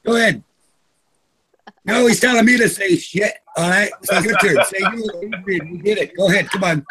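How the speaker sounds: normal talking voice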